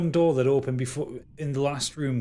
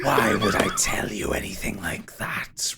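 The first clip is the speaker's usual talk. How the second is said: breathy, menacing villain voice; ghostly villain; 3 semitones lower than usual. Acted. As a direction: answer as a cryptic spirit, half-whispered. Game master voices Derethor.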